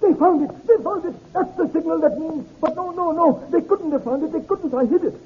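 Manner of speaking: high, panicked, desperate outburst; strangled cry. Acted. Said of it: "with a strangled cry".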